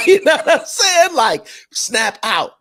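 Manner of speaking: squealing with laughter, stretching snap out slowly; laughing. Real, not acted. Cracking up after the punchline.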